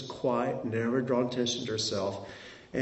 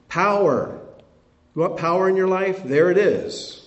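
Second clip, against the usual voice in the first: preaching loud; 7 semitones higher, 8 dB louder than usual. Real, not acted. Preaching climax on power.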